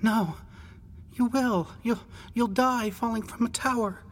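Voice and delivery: high adolescent voice, halting; tearful insistent boy. A narrator's reading. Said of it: "his voice thickened".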